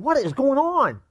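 high-pitched, baffled outburst; baffled reaction. Acted. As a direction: act out puzzled disbelief, pitched high. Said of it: "scratch your head and go".

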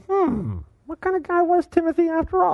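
high, mock-quizzical musing; puzzled hmm. Pastor musing aloud over Paul's letter, high mock-puzzled voice. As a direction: pretend to scratch your head over it. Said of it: "Things that make you go".

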